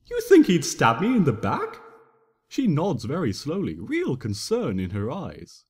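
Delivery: usual speaking voice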